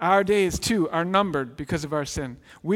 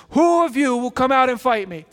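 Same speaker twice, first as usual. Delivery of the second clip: loud, higher mock-giant challenge; Goliath taunting; 7 semitones higher, 8 dB louder than usual. Giant shouting a challenge at the Israelites, booming and mocking. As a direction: bellow the dare at the cowering army.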